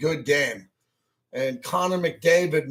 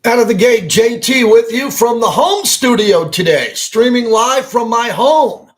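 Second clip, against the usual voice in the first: loud, higher, full-force host intro; radio show opener; 6 semitones higher, 11 dB louder than usual. Real, not acted. Radio host greeting listeners from the home studio, loud show-opener delivery. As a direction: punch out the show open at full volume.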